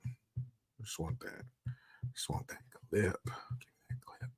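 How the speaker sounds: soft muttered, fading to whisper; whispered frustrated muttering; muttering to self. Real, not acted. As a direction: grumble under your breath while searching. Muttering while searching.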